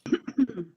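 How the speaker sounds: clearing throat after swallowing wrong; choking throat clear. Real, not acted. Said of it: "I'm about to choke".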